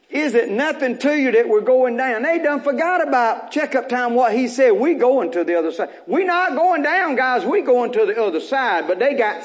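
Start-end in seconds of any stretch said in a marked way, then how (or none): 0.1-2.2 high, raised desperate cry; disciples' plea. Acted. Disciples pleading with sleeping Jesus, high desperate cry. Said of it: "And he said". 6.1-7.1 high, stressed "going" in "We not going down"; emphatic. Acted. Preacher telling church, stressed high "going".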